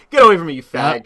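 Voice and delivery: fast, loud, laughing shove-off; mock-offended yelp, fast and loud; laughing mock outburst.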